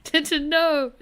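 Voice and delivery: loud cry of alarm; shouting. Real, not acted. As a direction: call out sharply. Calling out to character in game.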